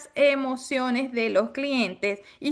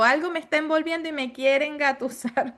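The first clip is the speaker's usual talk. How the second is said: normal talking voice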